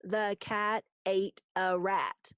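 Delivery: slow, each one-syllable word set apart. Shown as a demonstration. Teacher showing single-syllable words to pupils, word by word. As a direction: count it out one word at a time. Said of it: "single syllable words".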